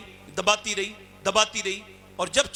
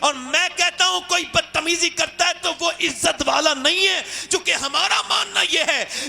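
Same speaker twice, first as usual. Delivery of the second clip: high, loud shouted sermon climax; fiery preaching; 10 semitones higher, 4 dB louder than usual. Acted. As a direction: thunder the moral at the crowd. Preacher climbs to full force.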